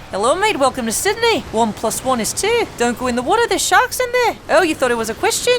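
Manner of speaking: put-on Australian accent with rising question endings; Australian, always questioning. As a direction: lift every statement into a question, Aussie style. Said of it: "perpetuamente haciendo preguntas".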